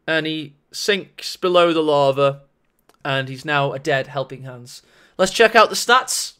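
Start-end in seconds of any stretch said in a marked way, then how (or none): 0.1-4.8 mock solemn. Caster narrates Helping Hands sinking into lava, mock solemn. Mock eulogy for the fallen Terminator.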